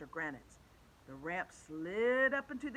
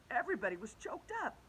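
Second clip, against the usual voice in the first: ordinary delivery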